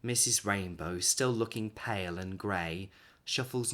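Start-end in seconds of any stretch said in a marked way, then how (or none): none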